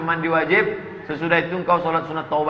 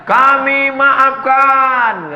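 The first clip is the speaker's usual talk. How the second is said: very slow, much higher and louder pronouncement; solemn forgiveness; 10 semitones higher, 6 dB louder, much slower than usual. Acted. Victim's family pardoning the killer, very slow and much higher. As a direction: draw it out grandly.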